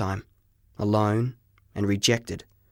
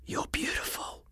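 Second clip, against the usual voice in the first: soft unvoiced whisper; under breath; 12 dB quieter than usual. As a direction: breathe it in awe. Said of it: "I said under my breath".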